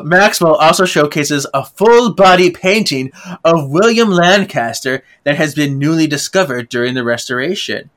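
usual speaking voice

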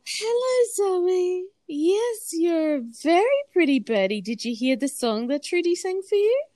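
high sing-song voice to the bird. Real, not acted. Talking to her pet bird.

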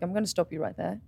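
firm quiet dad. Retold or imitated. Father stopping his daughter on FaceTime, softly and firmly. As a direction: lay down the rules quietly. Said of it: "he's like".

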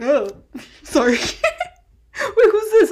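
high, loud words through laughter; laughing. Real, not acted. Friend asks who the pictured idol is, laughing. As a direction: giggle out the apology and question.

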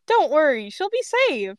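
high, sing-out reassurance; false reassurance. Acted. Shatton tells Grape not to worry, high reassurance.

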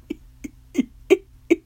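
a burst of high, loud laughter; a loud, high laughing fit; loud high cackle. Acted. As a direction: crack up hard. Cracking up.

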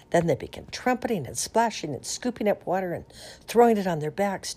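ordinary delivery

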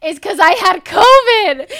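loud, emphatic, high-pitched list of complaints; outraged realisation. Retold or imitated. Daughter realising covid explains her symptoms, outraged realisation. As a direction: hit each symptom hard, as if it finally clicks.

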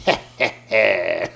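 slow, sly villain chuckle, imitating a toddler; mock evil heh heh heh; sinister little laugh. Granddaughter toying with grandpa, imitated sinister chuckle. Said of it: "But she did it sinister".

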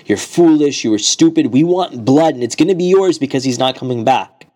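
loud bloodthirsty brothers taunting; angry accusing. Acted. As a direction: hound him, loud and furious. Brothers hounding Abu Dharr.

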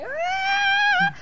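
shocked gasp. Retold or imitated. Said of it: "eu fiquei assim".

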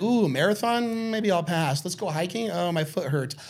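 high, excited mock voice; mock lazy friend. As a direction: perk up high at the food bit. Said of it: "You're like".

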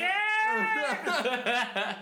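high, stretched mock yell; dancing yell. Drunk dancer landing and dancing again, high yell. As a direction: let out a high party whoop.

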